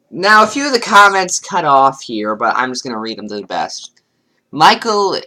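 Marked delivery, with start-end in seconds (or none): none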